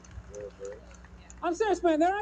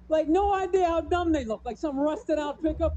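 usual speaking voice

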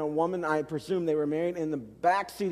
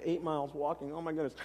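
dismayed mutter; 4 semitones lower, 10 dB quieter than usual. Retold or imitated. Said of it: "I do the math real quick".

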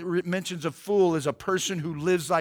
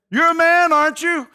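high, loud challenge; taunting shout; 9 semitones higher, 9 dB louder than usual. Acted. David taunts the king's bodyguard, loud shout. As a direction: call out a mocking challenge from far off.

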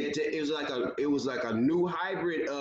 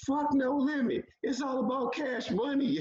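much higher, brash mock heckle; imitating a taunting stranger; 9 semitones higher than usual. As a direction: play the random dude dissing No Limit. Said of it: "but he said".

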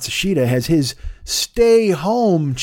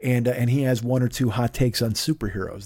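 ordinary talk

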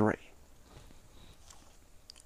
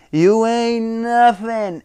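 slow, higher drawn-out taunt; mock Stone Cold snarl; 8 semitones higher, slower than usual. Recapping a wrestling promo, slow mock taunt. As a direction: lightly imitate the wrestler's sneer. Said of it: "the way he says".

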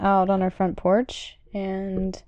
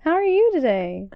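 higher, bright phone greeting; chirpy receptionist phone voice; 7 semitones higher, 4 dB louder than usual. Shown as a demonstration. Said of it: "That's, that's my phone voice".

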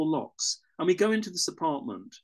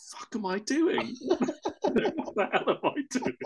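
laughing through it, higher; laughing disbelief; 7 semitones higher than usual. Real, not acted. Stuck with a sick drunk woman and cats, laughing. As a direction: giggle out the question at yourself. Laughing at his own absurd situation.

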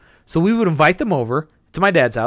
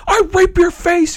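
angry kid gamer mock. Online teammate insulting you, high bratty whine. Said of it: "You're like".

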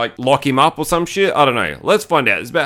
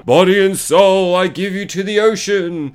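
imitated captain; 5 semitones higher than usual. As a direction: declaim it as the possessed captain, pitched up. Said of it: "The captain gets her".

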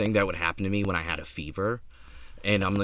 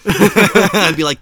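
laughing out loud; 9 semitones higher, 13 dB louder, much slower than usual. Real, not acted. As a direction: laugh through it. Laughing hard.

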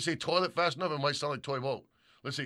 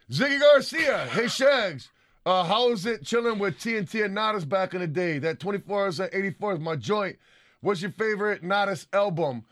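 raised, higher-pitched reading aloud; shout-out read; 6 semitones higher, 5 dB louder, slower than usual. Acted. Host reading a fan's question, raised emphatic voice.

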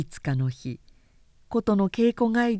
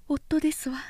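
whisper; 6 semitones higher than usual. Acted. Wife whispers husband is home.

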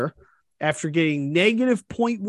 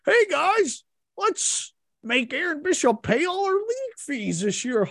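high, whiny mock voice, half laughing; imitating leaguemates ganging up on Bishop, mocking high voice; mocking taunt; 8 semitones higher than usual. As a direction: send up the group's gloating.

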